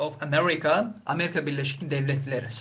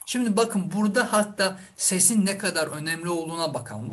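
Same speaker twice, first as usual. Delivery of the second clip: ordinary delivery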